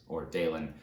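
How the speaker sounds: ordinary delivery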